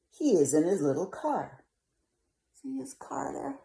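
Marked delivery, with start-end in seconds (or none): 2.5-3.6 whisper. Real, not acted. Whispered aside to viewers.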